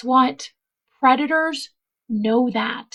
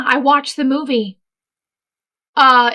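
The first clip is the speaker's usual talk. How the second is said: normal talking voice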